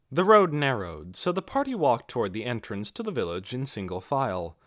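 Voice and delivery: plain reading voice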